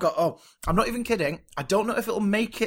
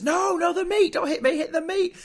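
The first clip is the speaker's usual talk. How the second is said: high, frantic panicked voice; panicked character; 13 semitones higher, 3 dB louder than usual. Retold or imitated. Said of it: "The other character says".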